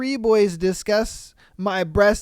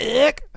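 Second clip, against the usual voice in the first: "Ick" thrown out higher and punchy; disgusted inflection; 4 semitones higher, 3 dB louder than usual. Shown as a demonstration. Host showing women how to reject creeps, "Ick" with disgusted inflection. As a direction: spit out "Ick" with exaggerated revulsion. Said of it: "throw that, like, inflection in there".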